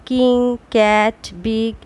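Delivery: কিং, cat, বিগ said slowly, one by one; slow example words. Shown as a demonstration. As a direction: give each example word its own slow beat. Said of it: "এই ওয়ার্ডগুলিকে উচ্চারণ করে দেখো".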